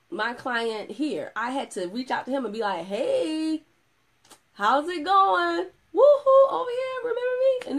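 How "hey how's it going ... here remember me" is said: high, loud calling out to get his attention; waving him down from far off, high and loud. Retold or imitated. Calling out to her client.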